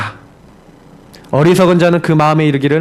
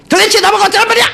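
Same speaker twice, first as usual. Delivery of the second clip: frustrated yell; 14 semitones higher, faster than usual. Retold or imitated. Pastor yelling at himself in the church bathroom mirror, exasperated shout. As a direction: shout it out, fed up and at a loss. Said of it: "소리가 막 질렀어요".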